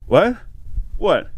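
high, loud repeated "what"; baffled. Retold or imitated. English guy lost at a French joke, acting out baffled surprise. His baffled reaction, retold.

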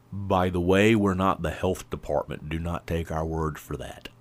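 close-mic disclaimer voice; leaning into the mic. Acted. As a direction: lean in close and drop to a disclaimer tone. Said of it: "lean into the mic and say that in a semi-different voice".

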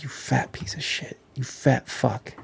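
hissed under the breath; whispered self-insult. Retold or imitated. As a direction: whisper it to your own reflection. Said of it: "I look in the mirror every day and just like".

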